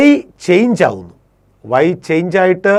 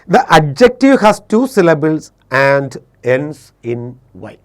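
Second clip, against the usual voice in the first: slow dictation; much slower than usual. Shown as a demonstration. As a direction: dictate the rule so students can write it. Teacher dictates the English rule. (പതുക്കെ പറഞ്ഞുകൊടുക്കുന്ന നിയമം)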